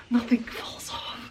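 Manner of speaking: quiet whispered hope; soft, slow whispered wish. Real, not acted. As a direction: whisper it like a prayer. Whispered repeat, hoping.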